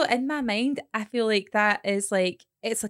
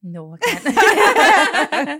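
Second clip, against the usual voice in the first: loud, drawn-out, higher no through laughter; laughing refusal; 6 semitones higher, 9 dB louder, much slower than usual. Real, not acted. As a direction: crack up while refusing.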